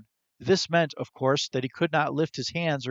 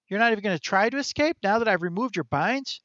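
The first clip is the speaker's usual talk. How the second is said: high chirpy squirrel voice; quick, high little critter; 7 semitones higher than usual. Acted.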